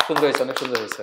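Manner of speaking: ordinary delivery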